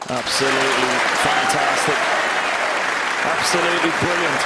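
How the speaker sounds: rising, loud live commentary; excited commentary. Real, not acted. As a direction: call the century with excitement. Excited commentary after century break.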